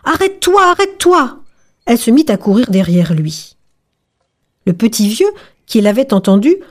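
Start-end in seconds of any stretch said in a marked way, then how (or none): 0.0-1.4 high-pitched call to stop; crying out. A narrator's reading. Old woman calls to the fleeing gingerbread man, crying out. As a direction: call out high after a runaway. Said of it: "la petite vieille cria".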